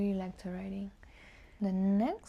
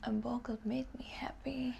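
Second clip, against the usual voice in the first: soft unvoiced musing on the prompt; whisper; 3 semitones higher, 6 dB quieter than usual. Real, not acted. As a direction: whisper the question to yourself. Whispers the question, then repeats it.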